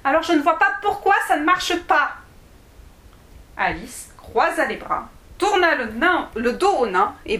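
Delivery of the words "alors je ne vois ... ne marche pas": higher, whiny complaint; petulant child. Acted. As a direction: sulk and protest like a vexed girl. Alice sulking, protesting. (petite fille boudeuse et vexée)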